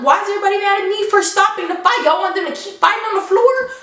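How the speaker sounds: high, fast, disbelieving complaint; exasperated protest. Retold or imitated. Defending herself to everyone, exasperated and high. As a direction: throw up your hands at them.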